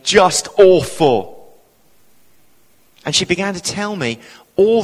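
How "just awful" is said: slow, loud, heavy-hearted reply; emphatic retold lament; dismayed. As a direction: imitate someone dragging out their misery. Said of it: "And she went".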